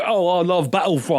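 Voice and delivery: light mock British accent as Boyega; British accent impression. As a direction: do a loose British fan voice. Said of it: "He's like".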